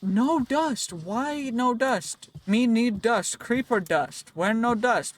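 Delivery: hammed-up, insistent begging; mock caveman whine. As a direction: playfully wail like a deprived caveman. Mock tantrum over missing creeper dust.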